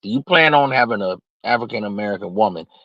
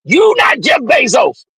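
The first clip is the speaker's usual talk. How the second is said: higher, louder, slow yell; shouting rebuke; 4 dB louder, slower than usual. Acted. Host yelling back at the whining young man. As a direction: shout him down, word by word.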